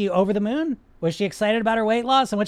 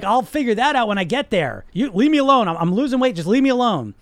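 louder, higher, fending off the question; defensive dieter; 4 semitones higher, 7 dB louder than usual. Retold or imitated. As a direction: leave me alone, I'm busy losing weight. Dieter refuses to discuss maintenance.

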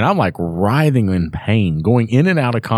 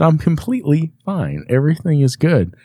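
high-pitched retold reassurance; overly keen to prove you're okay; mock insistence; 9 semitones higher than usual. Patient convincing the doctor to release him, insistent.

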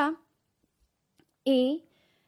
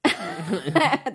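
laugh; 4 dB louder than usual. Real, not acted. Laughs at the question.

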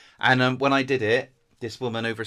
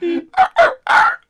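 high, loud imitation barking; dog bark; 13 dB louder than usual. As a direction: bark the audition take. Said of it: "And then I went".

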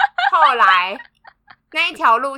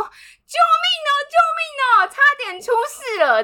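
calling for help; 13 semitones higher than usual. Retold or imitated. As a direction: wail it to the coworker as you burst in. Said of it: "我就大叫我同事的名字".

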